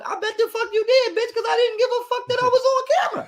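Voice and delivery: high laughing defiance; laughing retort. Real, not acted. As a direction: laugh it right back at them.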